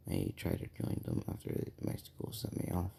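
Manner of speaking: a hushed low confession; quietly telling a secret. Retold or imitated. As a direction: keep it down so only he hears. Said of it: "very low for no one else could hear".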